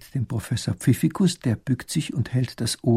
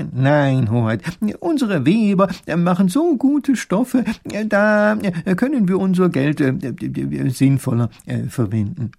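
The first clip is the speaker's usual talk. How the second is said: high, eager minister voice; a lightly voiced fussy professor; professor advising; 6 semitones higher, 4 dB louder than usual. Minister Pfiffikus advising the grand duke, high.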